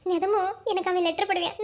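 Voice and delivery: usual speaking voice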